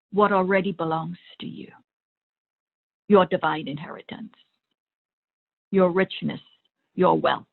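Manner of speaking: ordinary delivery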